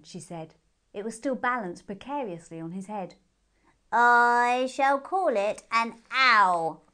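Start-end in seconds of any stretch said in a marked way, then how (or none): 3.9-6.8 loud, slow and stubborn; stubborn hare. A narrator's reading. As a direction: dig in, refuse to be corrected. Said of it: "said the hare stubbornly".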